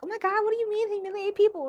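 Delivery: high, fast shocked innocent voice; naive beginner. Retold or imitated. Her newbie self, high and fast in shock. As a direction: play the clueless newbie. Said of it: "when I first started, I was like".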